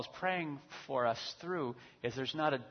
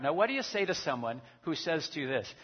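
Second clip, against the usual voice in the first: ordinary talk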